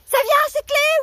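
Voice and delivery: high, loud exasperated yell; impatient shout. Retold or imitated. Yelling at the neighbour to bring his keys, loud and high. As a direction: yell up at him to get moving.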